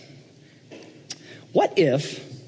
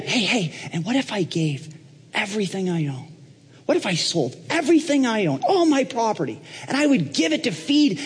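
eager high-pitched pitch, building higher; Donald Trump; 9 semitones higher than usual. Acted. Trump offering Paul everything he owns, excited and climbing higher. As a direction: jump in and build to a grand promise. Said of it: "Donald just jumps right in again".

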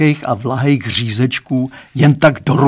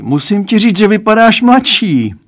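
a lightly raised, fatherly voice; Lucka's father; 9 semitones higher, 5 dB louder than usual. A narrator's reading. Dad confiding to daughter's boyfriend, lightly voiced father. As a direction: play the girl's dad, higher and a bit louder.

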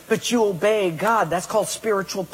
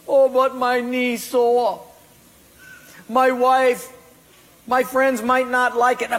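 whining excuse; 6 semitones higher, 3 dB louder than usual. Acted. Whiny excuse to God.

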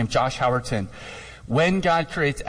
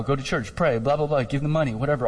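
ordinary talk

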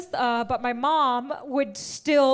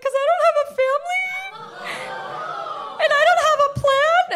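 high dramatic wail; 14 semitones higher, 5 dB louder than usual. Retold or imitated. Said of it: "I was like".

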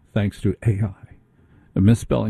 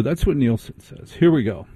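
ordinary talk

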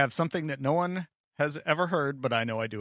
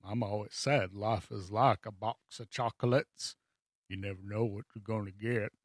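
a hint of Forrest Gump, lower; Forrest Gump impression; 5 semitones lower, 7 dB quieter than usual. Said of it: "the legendary character Forrest Gump".

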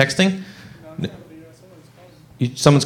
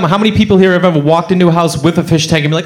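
ordinary delivery

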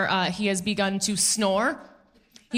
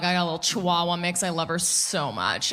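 usual speaking voice

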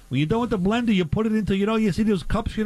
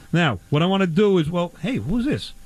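usual speaking voice

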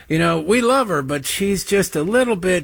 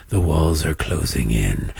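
deep, dark doom-laden pronouncement, pitched far lower; mock TV anchor intoning "The walls are closing in." like a dire prophecy; ominous news pundit; 12 semitones lower than usual. Mocking MSNBC hosts to listeners, a dark low doom voice. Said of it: "MSNBC saw ratings dips after years of going".

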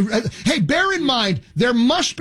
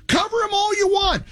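scornful outburst. Real, not acted. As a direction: fling it at them like a dare. Host mocking CNN.

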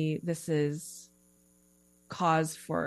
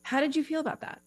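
light, higher, quick voice asking itself how it feels; gentle self check-in; 8 semitones higher, faster than usual. Retold or imitated. Coach modelling a self check-in, lightly higher and quick. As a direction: play a kind inner coach checking in, voice lifted high. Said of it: "I always encourage people to be like".

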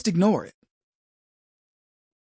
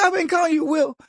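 high, dismayed admission; retold dismay, pitched up; surprised realization; 9 semitones higher, faster than usual. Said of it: "I go".

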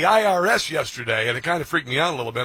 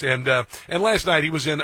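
normal talking voice

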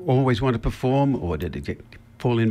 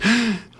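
sharp high mock gasp of panic; stage-fright gasp; 10 semitones higher than usual. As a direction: gasp like a terrified actor. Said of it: "gets stage fright and says".

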